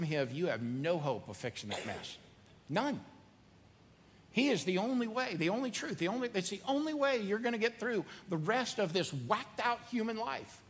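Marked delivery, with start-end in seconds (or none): 2.7-10.6 impassioned climax. Real, not acted. Preacher pleading with the doubting listener, impassioned and high. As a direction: drive it home with rising fervor.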